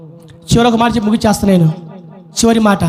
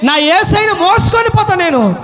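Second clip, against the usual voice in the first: high, impassioned cry; determined Mary; 9 semitones higher than usual. Acted. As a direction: cry it out with fierce devotion, in character. Acts Mary's determined plea. (ఆర్తితో ఎలుగెత్తిన కేక)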